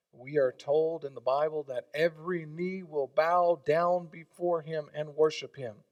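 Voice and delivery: ordinary talk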